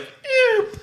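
very high mock squeak; tiny squeak. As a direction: squeak it like a cartoon mouse. Imitating splash noise.

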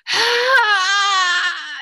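long, loud high wail; imitated man's cry. Showing listener how the man sounded, loud pained cry. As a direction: cry out loudly, drawn out, in imitation. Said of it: "It was a man".

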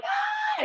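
a short high squeal, hammed up; mock scream. Actress hearing she's cast, mock joyful scream. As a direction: squeal like she can't believe she got the part. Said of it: "she went like".

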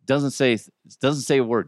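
ordinary talk, nothing marked

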